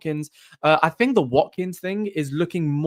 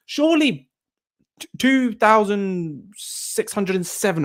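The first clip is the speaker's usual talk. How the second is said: mock pleading; 7 semitones higher, 3 dB louder, slower than usual. Escalating the subscriber plea.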